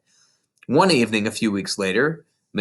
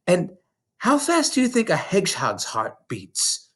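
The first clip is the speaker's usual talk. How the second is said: put-on lightly raised little girl voice; Matilda's voice; 8 semitones higher than usual. A narrator's reading. Narrator voicing young Matilda to her teacher, small girl voice. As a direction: voice a bright, earnest young girl.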